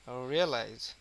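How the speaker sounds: "Realise" with heavy stress on the opening "রি"; teacher's demo of "realise", first syllable pressed. Shown as a demonstration. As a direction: lean into the "রি" of "realise". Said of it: "রি এর উপরে কিন্তু প্রচুর".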